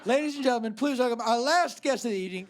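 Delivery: ordinary delivery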